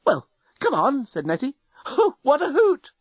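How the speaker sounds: bubbling with delight; thrilled. A narrator's reading. Nettie urges the others on, thrilled and gleeful.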